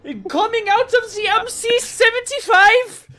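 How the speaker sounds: high, shouted German caricature; bad German accent. Co-caster requests German before the match intro, shouted mock German accent. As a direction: yell like a hammed-up German announcer. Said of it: "Do German. I actually can barely do a German".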